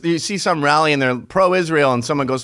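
normal talking voice

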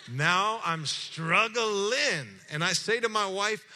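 high, very slow and loud, dragging out each word; excited. Real, not acted. Pastor telling church his struggle, loud and drawn out. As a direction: stretch it out like a heavy burden, rising.

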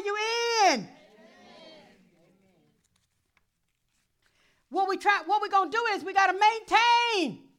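high, loud shouted preaching; preaching at full force. Acted. Pastor shouting the call to the congregation, high and loud. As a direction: shout it out like a revival.

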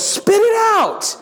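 very high, drawn-out shriek; hammed-up, slow, high-pitched scream; exasperated mom's yell. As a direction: imitate a mother yelling at a child. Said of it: "so my mom goes".

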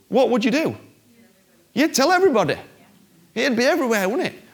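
high, loud rhetorical push; rally the congregation; excited. Real, not acted. Preacher urging the church to spread the news, high and loud.

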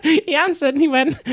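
very high, loud mocking posh-kid voice; whiny posh youth. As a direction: imitate an overexcited posh boy.

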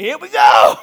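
loud hammed-up shout as he swings; big goofy shout at the tee; mock battle cry. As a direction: yell it like a slugger stepping up.

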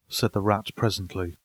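ordinary delivery, nothing marked

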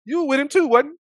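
high, loud street-style call-out; mock servant girl. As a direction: imitate a nosy girl calling someone out. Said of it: "she like".

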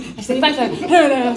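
usual speaking voice

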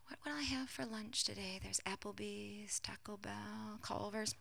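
very soft, half-whispered wondering aloud; idle daydream musing. Pretend. Mock god pondering lunch, absent-minded murmur. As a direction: think aloud softly, as if lost in a daydream.

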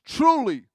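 "Truly" punched high and loud; emphatic. Real, not acted. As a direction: hit "Truly" hard for emphasis. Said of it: "That means emphasis".

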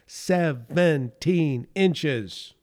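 very slow, each syllable of "Seventeen inches." set apart. Retold or imitated. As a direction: spell out "Seventeen inches." beat by beat, decidedly. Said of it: "decidedly remarked syllable by syllable".

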